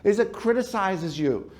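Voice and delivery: ordinary talk, nothing marked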